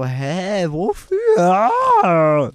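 high, loud frustrated cry; exasperated outburst. Real, not acted. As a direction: blurt it out in exasperation. Complaining about war costs.

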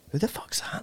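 under-the-breath whisper, baffled; whispered aside. Retold or imitated. Walking away from a stranger's hello, whispering to himself. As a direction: whisper it to yourself, puzzled. Muttering to himself after greeting.

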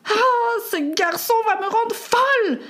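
very high, exasperated wail; exasperated outcry. Acted. Mother driven mad by her son, exasperated outcry. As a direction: cry out as a mother at her wits' end.

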